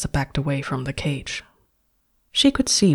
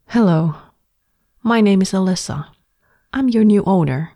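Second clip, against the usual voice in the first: Alyssa's voice, higher, louder and bright with bravado; brave smile; 5 semitones higher, 5 dB louder than usual. A narrator's reading. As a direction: greet him boldly, putting on courage. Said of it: "she gave him a brave smile".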